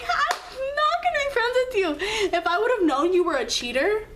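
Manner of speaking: high loud indignant refusal. Retold or imitated. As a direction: refuse him with heated outrage. Quoting her refusal of friendship.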